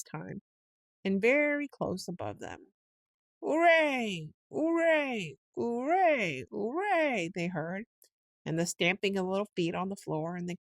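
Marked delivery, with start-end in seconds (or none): 3.4-7.3 distant cheering. A narrator's reading. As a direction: cheer like a drunken party crowd. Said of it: "shouting and cheering".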